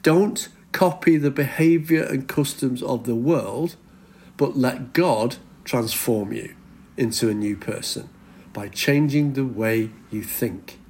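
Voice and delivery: measured, weighty repetition, settling down in pitch; deliberate repeat. Shown as a demonstration. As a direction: repeat it phrase by phrase so it sinks in. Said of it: "Let me just repeat that".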